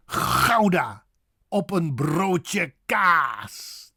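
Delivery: Gouda with rasped g, slow stretched broodje kaas; drawn-out native broodje kaas; Dutch pronunciation. Shown as a demonstration. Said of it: "the way a Dutchman would say".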